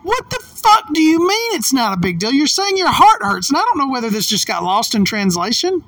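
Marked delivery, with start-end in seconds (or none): 0.0-3.5 high-pitched, incredulous outburst; retold outrage, pitched right up on the swearing; exasperated disbelief. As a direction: throw it back at him in disbelief. Retelling her outraged reply to him.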